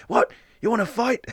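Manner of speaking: mock Australian challenge, high-pitched; Russell Crowe. Imitating cartoon Russell Crowe challenging strangers, bad Australian accent. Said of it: "this really shitty, like, Australian accent".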